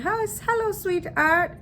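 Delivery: high-pitched mock British greeting; British accent. Stranger greeting friend's boyfriend, mock British accent. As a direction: put on a sing-out British hello. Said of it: "with the British accent".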